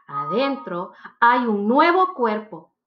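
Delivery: ordinary delivery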